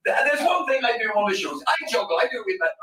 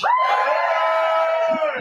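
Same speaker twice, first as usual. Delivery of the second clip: cheering hey; 4 dB louder than usual. Real, not acted. Crowd call-and-response cheer.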